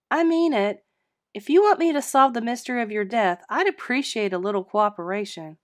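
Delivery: high, loud stern demand; exasperated scolding. Acted. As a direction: scold him into showing himself. Charlene scolds absent ghost.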